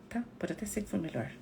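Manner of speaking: ordinary talk, nothing marked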